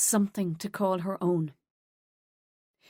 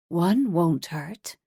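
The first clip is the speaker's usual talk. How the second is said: slow tempting voice; 3 semitones lower, 3 dB louder, much slower than usual. Acted. Temptation voice in her head.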